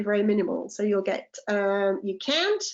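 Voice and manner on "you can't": American "can't" with the vowel of "can"; higher American-style "you can't"; American pronunciation. Shown as a demonstration. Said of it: "if you go to the United States".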